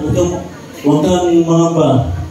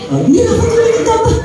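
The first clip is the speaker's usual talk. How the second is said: Ah! pitched much higher, in mock surprise; mock surprised exclamation; 14 semitones higher than usual. A narrator's reading. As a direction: exclaim it like a shocked storyteller. Exclaiming the father's sleepless night.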